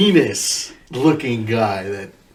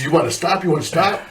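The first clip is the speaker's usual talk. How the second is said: higher-pitched mock challenge; yelling drill instructor; 5 semitones higher, faster than usual. Drill instructor to struggling recruit, mock yelled challenge. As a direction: taunt like an instructor daring someone to quit. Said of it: "he's yelling at me".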